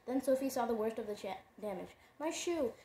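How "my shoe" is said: high cry of loss; dismayed cry. A narrator's reading. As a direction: cry out over the ruined shoe. Sophie sees broken heel.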